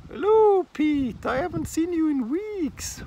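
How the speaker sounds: very high, very loud squawking parrot; extremely high talking bird squawk. Acted. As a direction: shriek the greeting like a cheeky parrot. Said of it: "the parrot says".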